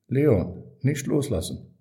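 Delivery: loud, high urgent shout; frightened mother's cry. A narrator's reading. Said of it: "schrie sie".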